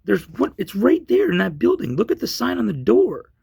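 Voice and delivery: loud, high-pitched retold argument; exasperated insistence. Man pointing police to guitar store, exasperated. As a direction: insist on the obvious, voice climbing.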